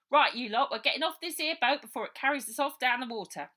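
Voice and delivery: loud, fast high call to the group; girl rallying the kids off the boat. Acted. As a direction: round everyone up. Baby rallying the children.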